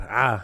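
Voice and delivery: "Ah" as the open Arabic vowel letter; Arabic letter sound. Shown as a demonstration. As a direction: demonstrate "Ah" on its own, open-mouthed. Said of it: "the letter is a ah".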